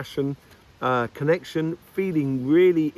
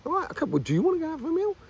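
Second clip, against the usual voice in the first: much higher, eager little voice; eager imitated asker; 14 semitones higher, much faster than usual. Asking someone sympathetic out for a meal, in a higher eager voice. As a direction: pop the question in a bright, raised voice. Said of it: "And kinda go".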